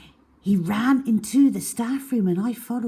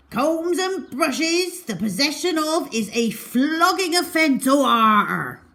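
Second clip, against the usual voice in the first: gruff pirate captain; 9 semitones higher, 4 dB louder than usual. A narrator's reading. Pirate captain scolding child.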